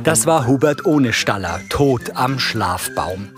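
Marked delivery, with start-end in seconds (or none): none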